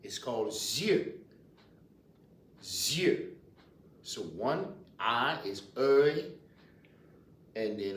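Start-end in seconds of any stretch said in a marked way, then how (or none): 0.5-3.3 "yeux" with a rising tune; Louisiana French pronunciation. Shown as a demonstration. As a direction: repeat "yeux" for students. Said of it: "A pair is called".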